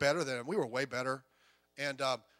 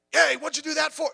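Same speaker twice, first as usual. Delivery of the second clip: loud, higher angry coach; coach scolding; 10 semitones higher, 7 dB louder than usual. Retold or imitated. Coach scolding a girl who missed, loud and high. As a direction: bark at the player.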